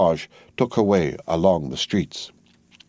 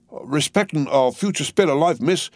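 higher, rough earnest servant voice; Jerry Cruncher; 8 semitones higher than usual. A narrator's reading. Cruncher answers Miss Pross, rough and earnest. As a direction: voice the trembling servant.

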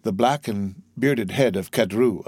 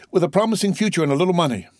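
put-on quick, higher young man's voice; buoy up an old father hurriedly; eager; 8 semitones higher, faster than usual. Son cheers his father.

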